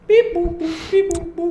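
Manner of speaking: very high robot beep-boom sounds. Pretend.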